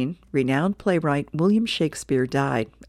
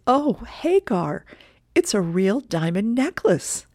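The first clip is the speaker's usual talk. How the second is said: higher, slow, delighted wife voice; Helga delighted; 4 semitones higher than usual. Acted. Helga opening her husband's gift, slow and delighted. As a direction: swoon over the necklace.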